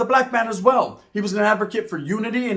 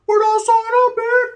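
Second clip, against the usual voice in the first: mock voice; 5 dB louder than usual. Mock voice of brown men.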